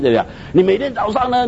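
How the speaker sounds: usual speaking voice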